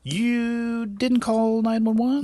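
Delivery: high, slow, drawn-out disbelief; incredulous. Real, not acted. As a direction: stretch the first word high in astonishment. Incredulous question to the storyteller.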